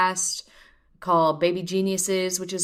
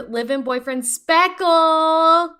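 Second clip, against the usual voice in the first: slow, much higher and louder gush; 10 semitones higher, 7 dB louder, much slower than usual. Real, not acted. Host swooning as she introduces Speckle, slow and gushing. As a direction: swoon over the name.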